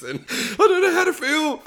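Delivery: high, mock choked-up voice; overwhelmed. Tough guy moved by their kindness, mock overwhelmed. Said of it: "Overwhelmed by it".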